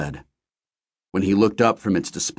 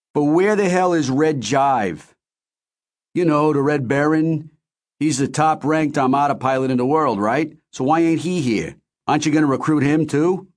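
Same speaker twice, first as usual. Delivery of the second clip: put-on brash, higher Philly accent; thick Philly accent; 8 semitones higher than usual. A narrator's reading. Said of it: "in his thick Philly accent".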